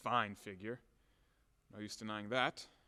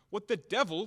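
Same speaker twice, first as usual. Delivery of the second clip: louder, higher exclamation; angry outburst; 4 semitones higher, 5 dB louder than usual. Acted. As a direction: burst out in temper.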